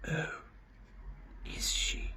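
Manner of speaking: soft, unvoiced menacing rasp; grey terrible voice. A narrator's reading. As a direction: breathe it like a ghoul. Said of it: "That grey and terrible voice".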